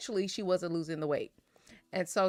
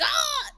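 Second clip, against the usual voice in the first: high yelp of pain; mock pain cry; 4 dB louder than usual. Woman in pain on the floor, high pained yelp. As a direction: yelp as the cramp grabs. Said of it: "She was like".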